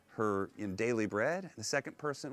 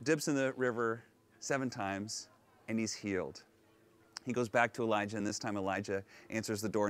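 ordinary talk, nothing marked

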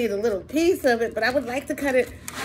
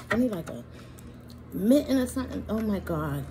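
normal talking voice

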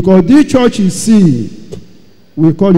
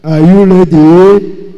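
slow, louder call to the crowd; pastor calling out; 5 dB louder, slower than usual. Retold or imitated. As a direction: ask it slowly and firmly, like a pastor ready to go.

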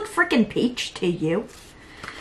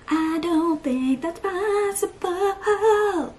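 mock doubtful; 8 semitones higher, much slower than usual. Slow put-on voice.

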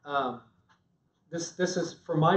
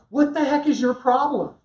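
sharp, higher, louder "what the heck"; annoyed snap; 8 semitones higher, 5 dB louder than usual. Retold or imitated. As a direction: snap back at her, offended. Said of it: "I'd be like".

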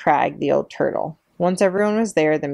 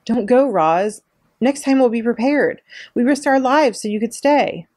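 higher, louder pleading cry; 7 semitones higher, 4 dB louder than usual. A narrator's reading. Animals crying out to Roz, begging her to stay, higher and louder. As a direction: beg her loudly not to leave. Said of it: "Voices cried out".

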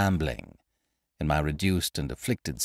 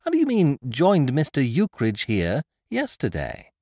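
astonished; 7 semitones higher, 5 dB louder than usual. Acted. Narrator echoes astonished.

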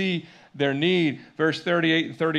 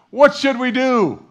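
high, loud plea, drawn out; slow, raised-voice appeal; urgent crying out; 9 semitones higher, 9 dB louder, slower than usual. Acted. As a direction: cry it out like a convicted crowd. Acts out the hoped-for crowd response.

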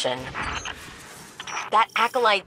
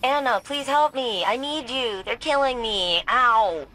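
higher, drawn-out fake begging; hammed-up helpless victim, pitched up; mock plea for help; 5 semitones higher, slower than usual. Granddaughter mimicking grandfather begging her for rescue, mock-pleading. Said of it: "None of that sounded like".

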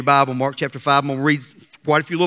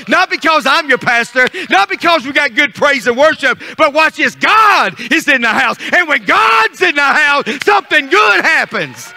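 high, driving revival preaching; impassioned pulpit cadence, rising in pitch; full-force preaching; 8 semitones higher, 5 dB louder than usual. Real, not acted. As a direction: build the congregation up to a shout.

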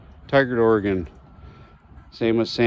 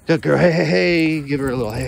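higher, louder cheering for the dog; excited dog praise; 6 semitones higher, 4 dB louder than usual. Real, not acted. Owner revving up his dog during tug, excited. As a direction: rev her up with bright praise. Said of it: "get her excited".

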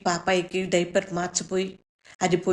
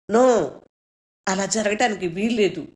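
anguished shout; 5 dB louder than usual. Retold or imitated. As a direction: scream the refusal, then hold it loud and pleading. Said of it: "గట్టిగా అరిచి పెద్దగా ఏడుస్తున్నాను".

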